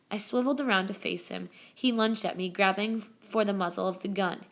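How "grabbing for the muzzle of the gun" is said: ordinary delivery, nothing marked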